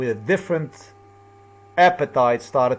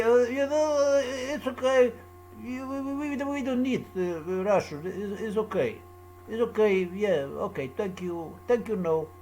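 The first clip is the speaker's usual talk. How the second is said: high, soft voice for his quote, higher and softer; imitating him; 6 semitones higher, 6 dB quieter than usual. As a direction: play him brushing it off, high and hushed. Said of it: "Just as he would say".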